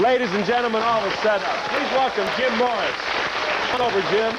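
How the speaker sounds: high, loud stage introduction; announcer introduction. Real, not acted. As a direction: bring the guest on with showman's volume. Host introduces guest as announcer.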